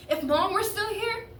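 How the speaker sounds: high, loud, rising appeal; upset protest. Acted. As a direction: start a challenge and leave it hanging. Teen protests to father, breaks off.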